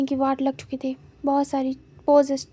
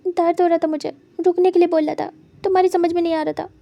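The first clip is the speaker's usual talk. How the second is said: higher, louder, fast angry complaint; hurt protest; 4 semitones higher, 5 dB louder, faster than usual. A narrator's reading. Wing accusing Wang Ji of ignoring his pleas, loud and fast. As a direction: throw the pain back at him.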